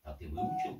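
ordinary talk, nothing marked

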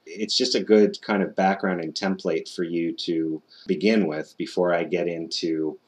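normal talking voice

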